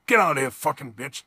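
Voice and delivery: normal talking voice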